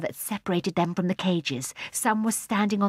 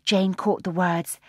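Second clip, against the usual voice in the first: ordinary delivery, nothing marked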